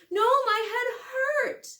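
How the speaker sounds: high, slow, sobbing wail. Acted. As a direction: cry it out, desperate. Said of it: "sobbing and gasping for breath".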